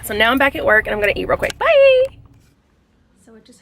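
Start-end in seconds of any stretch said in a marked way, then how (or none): 1.6-2.2 loud, high bright farewell; cheery goodbye. Real, not acted. Vlogger signing off to viewers, loud cheerful bye. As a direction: wave the viewers off happily.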